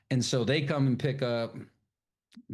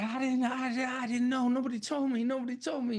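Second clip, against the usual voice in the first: pleading thief; 13 semitones higher than usual. Retold or imitated. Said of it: "And he's like".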